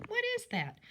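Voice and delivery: teacher playfully asking the class what the picture is; mock puzzled question. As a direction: act puzzled to get the kids guessing. Teacher quizzing kids on picture.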